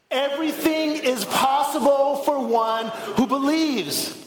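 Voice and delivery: slow, fuller, ringing declaration. Shown as a demonstration. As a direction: proclaim it from the heart. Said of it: "put some more conviction into it".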